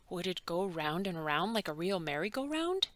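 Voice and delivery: plain reading voice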